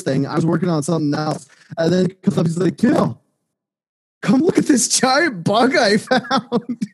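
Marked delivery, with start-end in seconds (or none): 2.8-6.9 excited coworker. Retold or imitated.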